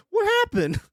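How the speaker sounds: very high squeak of surprise; a sudden high yelp of disbelief; shocked. Real, not acted. Streamer shocked by a drawing of a dead guy, squeaking.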